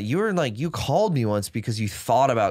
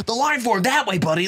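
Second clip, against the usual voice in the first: yelling; 12 semitones higher, 4 dB louder than usual. Retold or imitated. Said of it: "just literally just started screaming".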